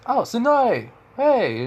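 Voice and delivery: high, loud mock-delighted hail; acted-out exclamation, pitch leaping up; excited greeting. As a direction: call out to someone you've just spotted. Jiraiya greets Tsunade on sight.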